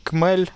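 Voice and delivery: "Kmel" with a hard K at the start; "Kmel", hard K in place of kh; guessed mispronunciation. Shown as a demonstration. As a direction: say "Kmel" as an English speaker would guess it. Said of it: "Probably say something like".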